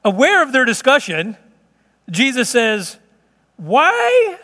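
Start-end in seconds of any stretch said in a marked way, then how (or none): 3.6-4.4 incredulous, high-pitched "why"; exasperated. Acted. Jesus questioning his disciples about bread, exasperated. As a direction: cry out in exasperated disbelief, much higher.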